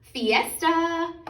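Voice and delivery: high, loud festive shout; a loud joyful fiesta call; party cry. A narrator's reading. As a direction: announce the party with big excitement. Story climax, party announced.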